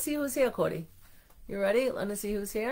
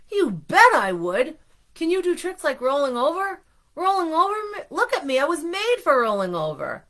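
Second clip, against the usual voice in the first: high, emphatic, animated character voice; excited acceptance; 8 semitones higher, 6 dB louder than usual. A narrator's reading. Arnie answering Mister Bing's invitation, excited and emphatic. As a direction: punch it with eager, louder delight. Donut eagerly accepts being a pet.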